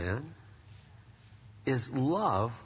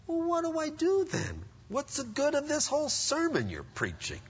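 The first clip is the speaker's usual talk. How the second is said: imagined listener; 9 semitones higher, 4 dB quieter than usual. Retold or imitated. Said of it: "imagined listener objecting".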